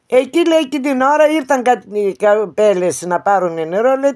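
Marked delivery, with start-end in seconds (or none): none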